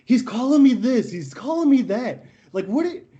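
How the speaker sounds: high-pitched mock complaint to the ref; indignant tattling. Opposing player complains to the ref about trash talk, mock indignant tattling. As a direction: imitate an aggrieved player telling on someone.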